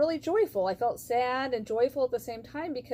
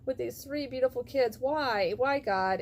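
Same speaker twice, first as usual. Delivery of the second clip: half-acted weeping question to God; tearful plea to God; 5 semitones higher than usual. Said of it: "I was crying".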